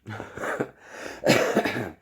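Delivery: a quick breathy laugh; short laugh. Real, not acted. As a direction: laugh lightly to yourself. Laughs at her own remark.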